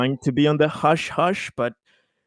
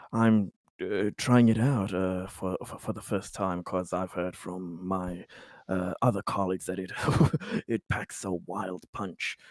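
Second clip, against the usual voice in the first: soft, low, halting businessman voice; hesitant corporate type; 5 semitones lower, 6 dB quieter than usual. Acted. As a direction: feel out a discreet business pitch, haltingly. Plays Conrad, a corporate buyer.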